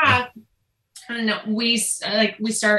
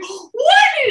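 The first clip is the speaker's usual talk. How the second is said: a much higher, louder stunned "What?"; shocked what; 6 dB louder than usual. Retold or imitated. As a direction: shriek it in total shock. Husband hears baby B.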